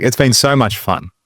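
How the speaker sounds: ordinary delivery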